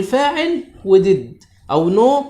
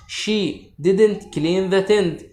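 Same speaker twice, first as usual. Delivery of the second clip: very slow, words spaced out; slow dictation; much slower than usual. Shown as a demonstration. Teacher dictating an example to pupils, very slow. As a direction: read the example slowly to the class.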